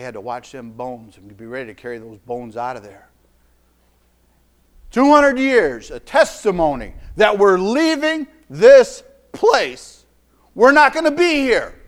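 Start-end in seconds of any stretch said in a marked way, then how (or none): 4.9-11.8 loud, drawn-out preacher's cry on the years; full-force preaching. Acted. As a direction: preach it out to the whole congregation.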